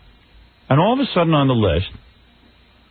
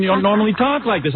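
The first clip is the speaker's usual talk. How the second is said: ordinary talk, nothing marked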